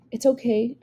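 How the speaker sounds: usual speaking voice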